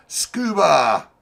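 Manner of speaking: high, very loud name call; drawn-out shout. Real, not acted. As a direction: holler the name like an announcer. Calls out the driver's name again.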